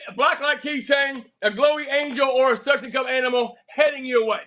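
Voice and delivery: ordinary talk, nothing marked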